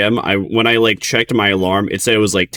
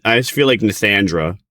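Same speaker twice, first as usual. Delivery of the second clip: usual speaking voice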